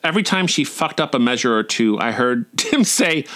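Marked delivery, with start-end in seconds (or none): none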